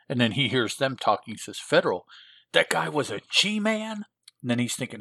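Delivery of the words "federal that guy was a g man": high, rising incredulous question; mock astonishment; acted surprise. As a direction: play the hero taken aback. Overhearing hero voiced in surprise.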